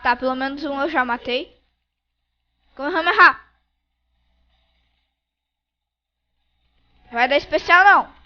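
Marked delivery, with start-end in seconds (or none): 2.7-8.1 loud fast gamer shouts; shouting in battle. Real, not acted. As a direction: yell fast mid-fight. Heated fight, shouting.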